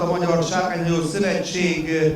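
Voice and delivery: ordinary talk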